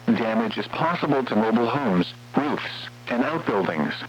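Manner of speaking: usual speaking voice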